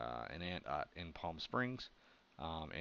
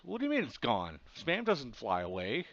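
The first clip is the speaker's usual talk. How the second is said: exasperated disbelief, higher; incredulous; 5 semitones higher, 5 dB louder than usual. Retold or imitated. Mom incredulous at missing Spam.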